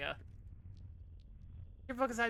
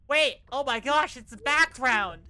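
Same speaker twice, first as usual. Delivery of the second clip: excited; 9 dB louder than usual. Real, not acted. Excited discovery in game.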